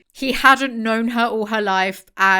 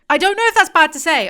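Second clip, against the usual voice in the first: higher, louder and fast, a bit panicked; flustered outburst; 8 semitones higher, 9 dB louder, faster than usual. Real, not acted. She worries aloud whether her remark was mean, flustered and fast. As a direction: blurt it out nervously. Anxious, fast, loud self-doubt.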